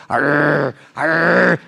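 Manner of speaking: low, growled arr, arr; mimicking a nervous dog growling. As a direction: growl like a scared little dog. Imitating the dog's growl.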